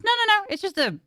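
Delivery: normal talking voice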